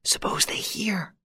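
hushed, frightened whisper; scared whisper. Acted. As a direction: whisper it in fear, high. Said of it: "Yvonne took fright".

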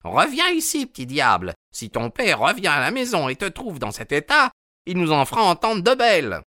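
high scolding woman's voice; scolding mother. A narrator's reading. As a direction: scold the little devil, high and sharp. Mrs Smith voiced, scolding. (mère qui gronde, voix aiguë)

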